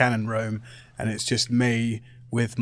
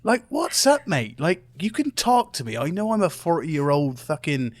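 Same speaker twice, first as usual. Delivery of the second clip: higher-pitched, lively pleading with a mate; exasperated concern; 6 semitones higher than usual. Acted. Confronting drunk friend about his ever-changing accents, exasperated concern. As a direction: press a friend to open up, exasperated.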